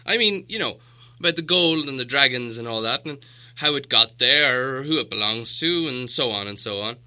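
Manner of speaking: hesitant, polite, a little shaky; confused Bilbo. A narrator's reading. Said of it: "feeling all confused and a bit shaky inside".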